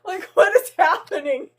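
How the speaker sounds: high, giggly talk through laughter; laughing while talking. Real, not acted. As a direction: speak through giggles, a bit shaken.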